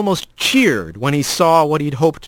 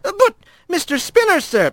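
high pleading cry. A narrator's reading. Adler pleads, cry heard.

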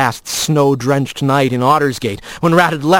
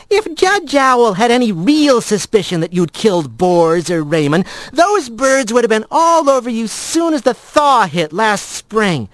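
higher-pitched, arguing the point; insistent friend Blaze; 6 semitones higher than usual. Acted. Blaze argues Rat is not being hunted, insistent and higher. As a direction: press the argument, voice lifted.

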